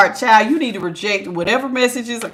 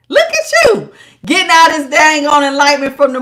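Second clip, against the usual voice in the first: delighted praise; 5 semitones higher, 5 dB louder than usual. Real, not acted. Praising the viewer.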